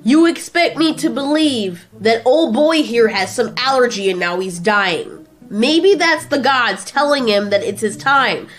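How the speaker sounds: high, loud incredulous rant; yelling. Acted. Godzilla refuses to believe in the allergy, yelling. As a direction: build into an exasperated tirade. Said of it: "Why are you yelling?".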